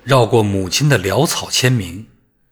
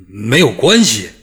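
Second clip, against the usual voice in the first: louder stunned echo; stunned disbelief; 5 dB louder than usual. A narrator's reading. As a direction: repeat it in shock. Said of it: "惊得目瞪口呆".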